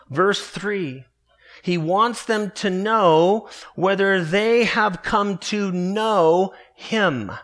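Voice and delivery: ordinary delivery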